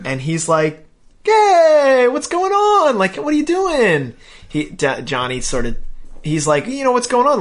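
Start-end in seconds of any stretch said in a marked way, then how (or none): 1.2-4.1 high, loud, gushing mock hello; hammed-up greeting. Car dealer hails his old rival, hammed-up greeting. 6.7-7.4 high-pitched chummy question; mock friendly greeting. Car dealer asks old rival his news, mock friendly greeting.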